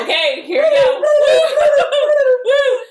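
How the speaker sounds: very high, loud laughing whoo; excited whooping. Retold or imitated. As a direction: cheer it with a laugh. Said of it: "they're like".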